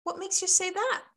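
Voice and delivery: high, lilting surprised query; questioning comeback. Shown as a demonstration. Coach models a reply to someone saying obnoxious stuff, high questioning comeback. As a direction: toss it back high and innocent. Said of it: "When somebody comes at you".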